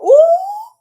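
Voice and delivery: very high, loud startled hoot; loud high "ooh". Real, not acted. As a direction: let out a piercing reacting "ooh". Startled reaction to the card.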